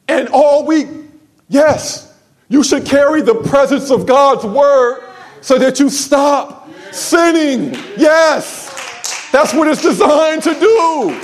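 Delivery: high, loud preaching, near a shout; full-force preaching. Real, not acted. Pastor rising to a shout at his church, high and loud. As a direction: shout it to the congregation.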